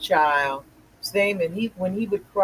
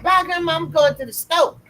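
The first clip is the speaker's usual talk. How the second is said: put-on high little girl voice, chirpy; 10 semitones higher, 3 dB louder than usual. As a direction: sing it out like a proud small girl. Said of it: "And it's about".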